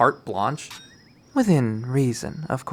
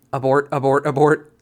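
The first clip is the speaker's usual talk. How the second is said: louder panicked repeat; frantic abort; 2 semitones higher, 5 dB louder than usual. Acted. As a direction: yell at yourself to bail out. Panicked inner voice.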